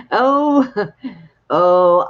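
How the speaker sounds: loud burst of laughter; laughing. Real, not acted. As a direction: laugh out loud, then gasp. Laughs at host's joke.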